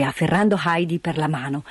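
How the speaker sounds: plain reading voice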